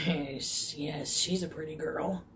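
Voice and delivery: soft, slow creepy purr; a hint of a creepy old man. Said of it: "he had a creepy voice".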